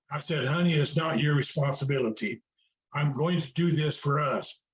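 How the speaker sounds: ordinary delivery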